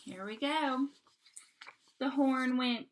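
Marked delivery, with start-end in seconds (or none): none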